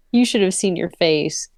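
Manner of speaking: ordinary delivery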